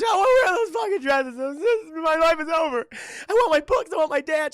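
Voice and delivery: high, loud mock wailing; hysterical sobbing. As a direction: bawl it out like a hammed-up meltdown. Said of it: "She's just not doing well".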